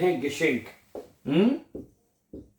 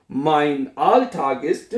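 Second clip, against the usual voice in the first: slow teaching pace; much slower than usual. Shown as a demonstration. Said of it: "क्या कहेंगे आप? जुमला बनाएंगे".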